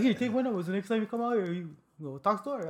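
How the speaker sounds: woman's friendly invitation. Retold or imitated.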